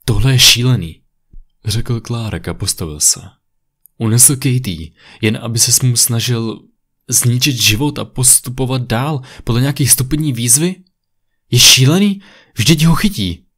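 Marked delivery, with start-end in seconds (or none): 0.1-1.0 loud, appalled exclamation; agitated outburst. A narrator's reading. Clark reacting to his friend's news, agitated outburst. 4.0-13.5 raised pitch building to a loud, high protest; incredulous outrage. A narrator's reading. Clark reacting to Katie's kidnapping, incredulous and agitated.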